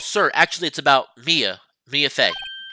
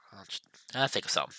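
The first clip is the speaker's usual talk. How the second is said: usual speaking voice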